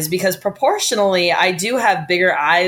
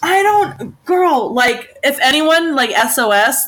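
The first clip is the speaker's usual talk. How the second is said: high-pitched, half-laughing disbelief; laughing exasperation; 7 semitones higher, 3 dB louder, slower than usual. Real, not acted. Appealing to a friend for help understanding, exasperated and amused. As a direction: plead in comic bafflement, voice jumping up.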